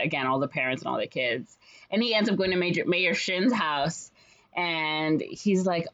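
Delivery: ordinary delivery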